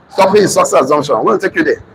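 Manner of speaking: ordinary talk, nothing marked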